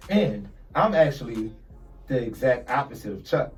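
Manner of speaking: normal talking voice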